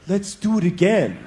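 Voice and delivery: slow, a hint of creepy. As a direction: say it slowly with mild creepiness. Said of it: "creepy like that".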